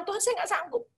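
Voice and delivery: begging God she cannot do it, tearful; tearful plea. Retold or imitated. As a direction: plead quietly. Pleading again.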